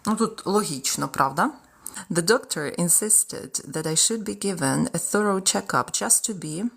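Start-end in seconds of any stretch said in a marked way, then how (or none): none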